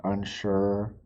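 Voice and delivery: ordinary delivery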